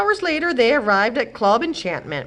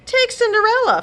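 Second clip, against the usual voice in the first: much higher, louder rant; 5 dB louder than usual. Acted. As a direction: complain about Cinderella, higher and louder. Godmother rants.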